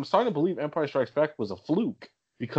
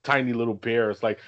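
ordinary talk

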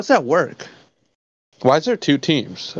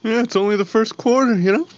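higher-pitched mock reassurance; imitating breezy Pats fan; 9 semitones higher than usual. Friend mimicking a Pats fan consoling losers, mock reassurance. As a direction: put on a rival fan's easy consolation. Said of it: "It's like".

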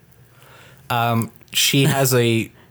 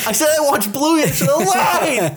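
laughing; 14 semitones higher, slower than usual. Real, not acted. Caught out, laughing.

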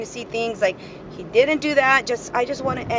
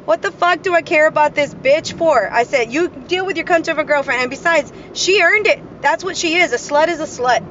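angry retort, raised in pitch and volume; pissed off; 5 semitones higher, 5 dB louder than usual. Retold or imitated. Woman tells off her ex about his girlfriend, pissed. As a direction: retell it with the anger still hot. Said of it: "I got pissed".

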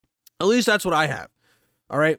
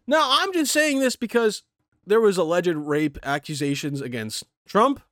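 normal talking voice

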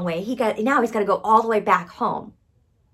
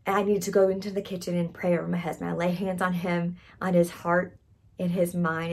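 ordinary talk, nothing marked

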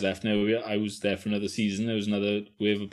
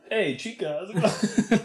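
higher, laughing mock Pablo chat-up line; laughing Pablo impression; 11 semitones higher, slower than usual. Friend mimics Pablo chatting up girls, laughing high voice. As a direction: tease Pablo's smooth pickup line through laughter. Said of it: "and then he just goes".

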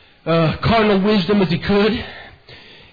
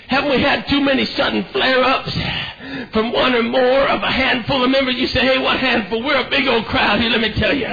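driving holiness sermon, gasping breath between phrases; full-force preaching; 5 semitones higher than usual. Real, not acted. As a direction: preach it hard in a relentless cadence.

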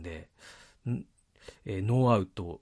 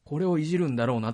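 lightly voiced, higher-pitched spectator reaction; a touch of mock excitement, pitched up; imitated excited audience; 7 semitones higher than usual. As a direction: mimic an audience member's keen anticipation. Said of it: "観客は".

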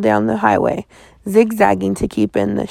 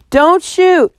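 high, louder breathless plea; wheezed plea; 9 semitones higher, 4 dB louder than usual. A narrator's reading. Girl wheezing at the sheriff from the creek. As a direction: gasp it out after surfacing. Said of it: "I wheezed".

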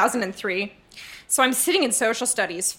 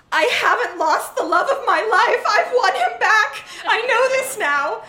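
much higher, laughing and gushing; overjoyed teenage diary gush; giddy excitement; 10 semitones higher than usual. Acted. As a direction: burst with lovestruck joy. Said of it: "Okay, now I'll calm down".

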